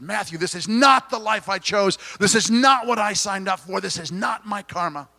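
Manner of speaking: frustrated friend. Retold or imitated. Friend in chronic pain protesting to Matthew, retold frustration. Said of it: "in absolute frustration".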